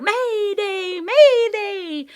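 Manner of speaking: very high, loud squawking cry; bird shriek. Acted. Bird warning everyone of danger, shrieking. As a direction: screech the alarm like a panicked bird. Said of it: "she shrieked".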